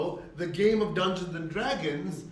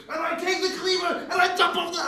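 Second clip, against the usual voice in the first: much higher and louder, gleeful battle narration; excited player; 8 dB louder, faster than usual. Retold or imitated. New player to the GM, thrilled about her troll attack, much higher and louder. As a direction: burst out with the thrill of the leap.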